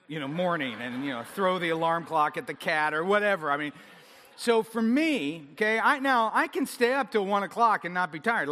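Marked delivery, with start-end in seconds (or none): none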